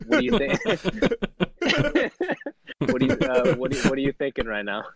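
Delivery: ordinary talk, nothing marked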